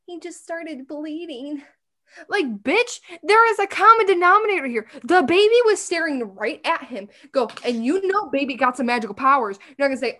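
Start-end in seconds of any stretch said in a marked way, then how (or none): 0.1-1.6 soft, slow, dumbly innocent imitation; mock clueless Miranda. Mocking Miranda telling the room, soft and slow. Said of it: "and Miranda's like". 2.3-7.1 louder, higher incredulous scolding; exasperated outburst. Real, not acted. Fan scolding Miranda for missing the obvious, loud. Outraged reply to Miranda's obliviousness.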